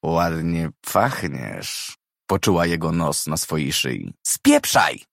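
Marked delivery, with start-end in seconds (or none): 0.1-2.0 put-on drunk, low and slow. A narrator's reading. Drunk man leaning close. 4.3-5.0 sharp high angry shout. A narrator's reading. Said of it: "krzyknęła".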